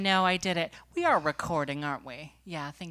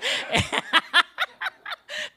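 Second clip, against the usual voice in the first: a squealing burst of laughter, far higher; 5 dB louder than usual. Real, not acted. Laughing at her own joke to the audience, high squealing laugh. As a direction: shriek with laughter.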